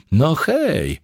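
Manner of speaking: high cheery call of hello; breezy high hello called across the desk. A narrator's reading. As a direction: sing out a greeting. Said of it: "zawołała".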